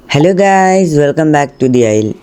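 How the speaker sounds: high, drawn-out hello to viewers; youtuber intro. Acted. Gamer greeting viewers at video start, slow high intro. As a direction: stretch the welcome slowly like a channel intro.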